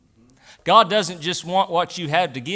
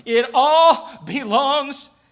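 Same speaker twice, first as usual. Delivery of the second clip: high, raised voice with stretched emphatic phrases; full-force preaching; 8 semitones higher, 7 dB louder than usual. Real, not acted. Pastor telling the congregation it belongs to God, preaching hard. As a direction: drive it home to the congregation.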